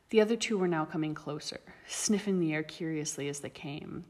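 ordinary delivery, nothing marked

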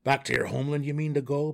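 loud, startled gnome question; cried out. A narrator's reading. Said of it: "Belwer cried".

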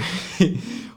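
soft unvoiced laugh of disbelief; breathy laugh. Real, not acted. Host realizing a listener pranked him, quiet laugh. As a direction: laugh under your breath at the joke. Caught by a joke letter.

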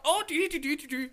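high leprechaun sing-song; Lucky Charms man. Retold or imitated. Co-host mocking the Irish friend's talk, cartoon leprechaun lilt. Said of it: "talk like the Lucky Charms man".

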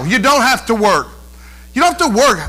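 normal talking voice

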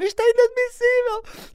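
furious parent protesting; outraged parent. Retold or imitated. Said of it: "pais a dizer". (pai indignado, aos gritos)

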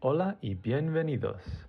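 gentle, hushed welcome to the listeners; a calm bedtime host greeting; soft show intro. Acted.

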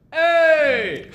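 high, drawn-out shout of "Hey"; rallying court-side holler; demonstrated yell. Shown as a demonstration. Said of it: "you gotta yell".

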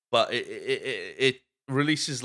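ordinary talk, nothing marked

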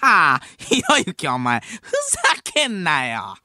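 big staccato laugh into high scolding; Hamada's laughing retort. Acted. Comedian firing back at a debater, laughing scolding impression. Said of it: "ひろゆきさんと浜田さんが".

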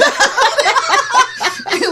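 a big shrieking burst of laughter; loud high laugh. Real, not acted. Mocking her own advice-giving, loud high laughter.

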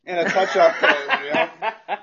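words breaking into laughter; cracking up while repeating a friend's joke; laughing along. Real, not acted. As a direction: laugh through the punchline echo.

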